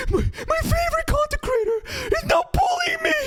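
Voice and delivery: high, loud, mock crying wail; mock sobbing fan. Upset fan crying to the creator, hammed-up high sobbing wail. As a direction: whimper it like a hurt crybaby. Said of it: "crying and sobbing, going".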